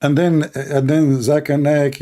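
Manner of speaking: ordinary talk, nothing marked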